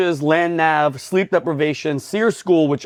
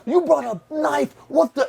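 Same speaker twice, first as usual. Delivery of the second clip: retold tirade, pitched way up; mock yelling; 10 semitones higher, slower than usual. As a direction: imitate instructors yelling from the next bay. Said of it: "yelling at, all you hear is".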